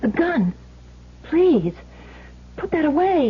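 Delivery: high, urgent begging; frightened plea. Acted. Wife begs the gunman to put the gun away, frightened.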